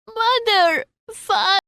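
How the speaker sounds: high, crying call cut off mid-word; desperate wail. Acted. Lost boy calling for his mother and father, wailing high. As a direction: wail for your lost parents. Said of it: "he wailed desperately".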